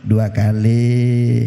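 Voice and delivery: deep, drawn-out "Dua kali" from a wife keeping score; wife's ominous count. Retold or imitated. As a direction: lay the words down low and heavy as a warning. Wife counting husband's offences.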